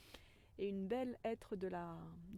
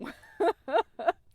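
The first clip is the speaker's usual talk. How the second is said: loud laugh; 9 semitones higher, 17 dB louder than usual. Real, not acted. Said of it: "Au cas où si j'avais pas compris".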